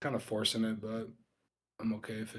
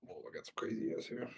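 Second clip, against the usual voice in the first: whispering; 4 semitones lower, 7 dB quieter, faster than usual. Real, not acted. Excited aside at the screen.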